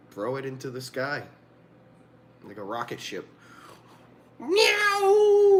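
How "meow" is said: high, loud mock meow; cartoon cat screech; cat yowl. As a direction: yowl like a cat flung skyward. Mock cat launched into sky.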